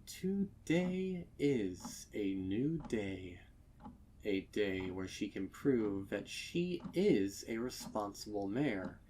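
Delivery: usual speaking voice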